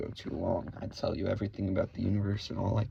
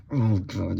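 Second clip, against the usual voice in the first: loud exasperated groan; 3 semitones lower, 6 dB louder than usual. Acted. Mock exasperation before insult.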